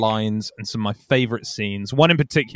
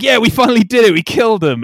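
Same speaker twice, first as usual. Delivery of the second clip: high, loud hammed-up cheer; mock villain triumph; 10 semitones higher, 6 dB louder, faster than usual. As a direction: crow over the victory like a cartoon villain. Villains celebrating the kill.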